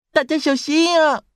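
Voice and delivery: very high, loud little-brother yell; piercingly high storybook alarm; loud warning cry. A narrator's reading. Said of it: "弟弟大声叫着".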